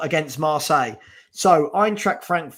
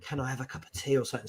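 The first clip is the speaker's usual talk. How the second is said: soft, fast mock whisper; 3 semitones lower, 11 dB quieter, much faster than usual. Husband teasing a mock whisper into his wife's ear. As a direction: breathe it lightly like a tease. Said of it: "I'm just gonna whisper something in her ear".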